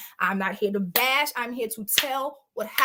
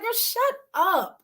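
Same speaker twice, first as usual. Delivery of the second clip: put-on slow, higher, dismissive "Girl, shut up"; a slow, raised-pitch brush-off; drawn-out "girl, shut up"; 9 semitones higher, slower than usual. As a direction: stretch "Girl, shut up" out slowly and brush her off. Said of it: "And I said it just like that".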